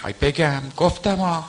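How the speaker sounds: a jeering parody of a mourning singer's wail; mocking eulogist's wail. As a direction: ham up a eulogist's cry. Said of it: "مداحی که بیاد مسخره در بیاره".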